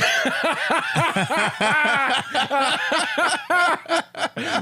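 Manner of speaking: long, high burst of real laughter; helpless laughter. Real, not acted. Hosts cracking up at the Germany film remark, helpless laughter. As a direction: crack up completely.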